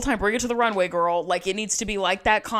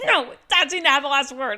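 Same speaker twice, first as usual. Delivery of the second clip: higher, put-on whiny voice; mock whine; 6 semitones higher, 4 dB louder than usual. Cohost imitating sobbing Kathy, mock whine. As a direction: imitate a sulky kid. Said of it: "She's like".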